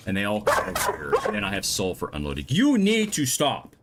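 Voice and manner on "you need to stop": slow, high, loud scolding; yelling. Real, not acted. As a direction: yell it at the dogs, drawing out each word. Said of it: "Sorry about the yelling".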